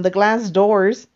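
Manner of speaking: ordinary talk, nothing marked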